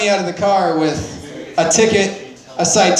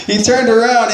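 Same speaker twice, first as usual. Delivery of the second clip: laughing while telling the story; 4 semitones higher than usual. Real, not acted. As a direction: breaks into a laugh mid-sentence.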